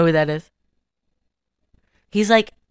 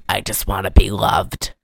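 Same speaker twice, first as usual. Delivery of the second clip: much lower, sad and deep; low mopey voice; 11 semitones lower than usual. Retold or imitated. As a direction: drop low and mope it out. Quoting Charlie Sheen character.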